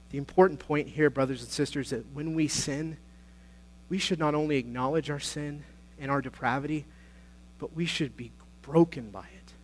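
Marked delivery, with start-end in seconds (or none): none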